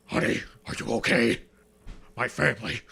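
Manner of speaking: like he's about to throw up; gagging. Retold or imitated. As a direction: phlegm stuck in the throat. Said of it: "Sounds like you're about to throw up".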